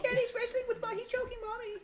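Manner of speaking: put-on tiny, very high child voice; cowering daughter. Daughter watching her parents fight, squeaking. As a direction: squeak it like a hammed-up scared little girl. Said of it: "She's like".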